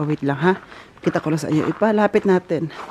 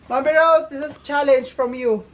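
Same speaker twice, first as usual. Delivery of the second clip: ordinary talk, nothing marked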